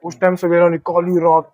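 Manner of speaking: usual speaking voice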